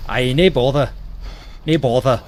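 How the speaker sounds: attempted Scottish accent; amateur put-on Scottish accent. Shown as a demonstration. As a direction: try out a casual Scots brush-off. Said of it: "if that sounds Scottish at all".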